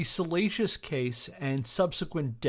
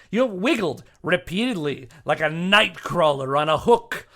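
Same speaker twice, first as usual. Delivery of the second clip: indignant formal statement; 3 semitones higher, 7 dB louder than usual. Acted. Reading Jack's statement at the referee, indignant and loud. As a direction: read it like a furious legal brief.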